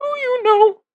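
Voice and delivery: high, tiny gingerbread man voice. Shown as a demonstration. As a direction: squeak it like a cookie man. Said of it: "have you heard my gingerbread bra-, man impression?".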